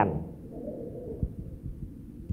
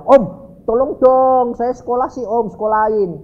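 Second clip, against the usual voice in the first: put-on kid's insistent high appeal; pleading child; 7 semitones higher, 4 dB louder than usual. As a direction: plead for a favour, stretching the words. Child begging uncle for help.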